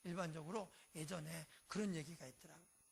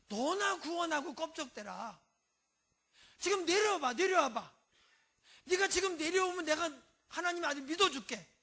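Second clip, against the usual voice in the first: higher, louder mocking taunt; jeering mockery; 7 semitones higher, 9 dB louder than usual. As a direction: yell the crowd's sneer up at the cross. Mockers jeering at crucified Jesus.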